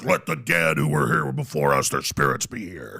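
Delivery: very low ritual intonation. Retold or imitated. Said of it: "this high priest comes out".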